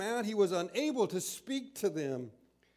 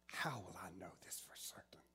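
put-on a hushed, disbelieving old man asking; doubting whisper; 12 semitones lower, 17 dB quieter, faster than usual. Zacharias whispering his doubt to the angel. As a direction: whisper the doubting question fast and low.

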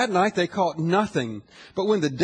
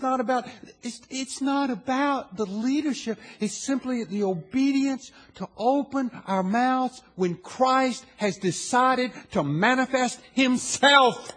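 building preaching; 4 semitones higher, slower than usual. Acted. Pastor rallying the congregation to turn to Christ, building sermon. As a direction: climb from earnest to full-force call, slowly.

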